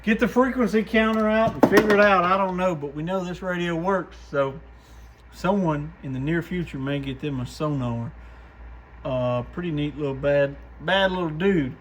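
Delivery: ordinary talk, nothing marked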